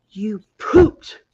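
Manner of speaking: put-on loud, lightly childlike accusation; imitating little girl. Mom mimics daughter accusing dad at the gate, loudly. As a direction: accuse like a blunt toddler. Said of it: "and, and she's like".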